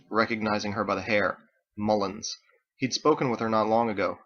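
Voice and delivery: usual speaking voice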